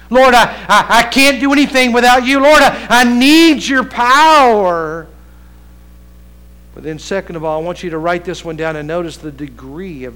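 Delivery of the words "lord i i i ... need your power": loud, high impassioned plea; crying out to God. Acted. Preacher voicing Elijah begging for power, crying out. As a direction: cry out, stretching the final words. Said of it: "Elijah's crying out to God".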